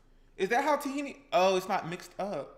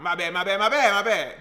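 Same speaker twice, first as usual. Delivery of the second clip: rapid-fire repeated apology, building louder; 8 dB louder than usual. Pretend. Cook apologizes over and over, getting louder. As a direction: let the last one swell.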